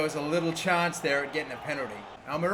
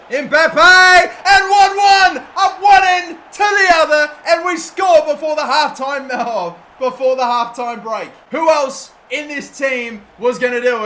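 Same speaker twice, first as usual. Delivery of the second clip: goal celebration; 9 semitones higher, 13 dB louder than usual. Real, not acted. Goal scored in final.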